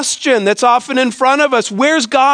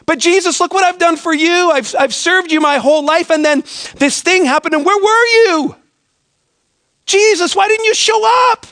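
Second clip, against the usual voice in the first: pleading complaint to Jesus; 7 semitones higher than usual. Retold or imitated. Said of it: "Some of us think we've done good by Jesus".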